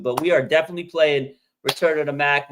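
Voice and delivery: ordinary talk, nothing marked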